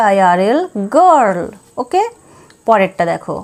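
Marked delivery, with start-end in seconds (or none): none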